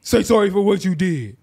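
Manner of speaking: loud, insistent demand for sorry; mock demanding girlfriend. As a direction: bark the demand like an angry girlfriend. Acted angry girlfriend demanding apology.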